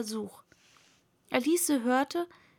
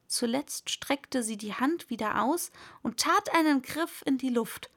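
ordinary delivery

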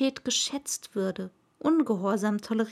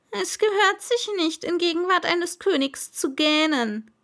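high, loud royal scolding; stern pompous king, much higher and louder; admonishing king; 7 semitones higher, 4 dB louder than usual. Acted. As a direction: correct the boy's manners from the throne. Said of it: "ermahnte ihn der Monarch".

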